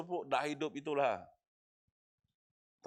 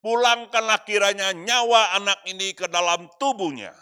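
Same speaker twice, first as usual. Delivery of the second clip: crying out to God; 5 semitones higher, 11 dB louder than usual. Acted. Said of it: "dia berseru kepada Tuhan".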